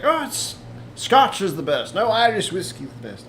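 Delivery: put-on a jokey Irish accent arguing over whisky; mock Irish accent. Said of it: "I don't know why the Irish sound like this".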